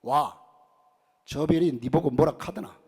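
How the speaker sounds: Gyeongsang husband. Retold or imitated. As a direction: brush off the wife in Gyeongsang dialect. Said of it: "경상도 남편이 왈". (무뚝뚝한 경상도 남편 말투)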